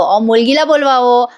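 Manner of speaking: mumbled through a mouthful of gutkha; mouth-full mock of the groom; talking with a mouth full of supari. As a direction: say it with something stuffed in your cheek. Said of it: "तो तोंडात ते सुपारी ठेवून बोलतोय ना".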